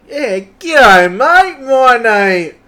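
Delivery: drawn-out g'day from a matey tradesman; Aussie tradie. Acted. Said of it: "what's a typical tradie name".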